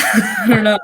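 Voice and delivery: real laugh breaking through the words; laughing off own sincerity, almost teary. Real, not acted. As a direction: giggle through it, a little overcome.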